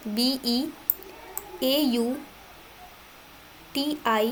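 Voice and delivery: ordinary talk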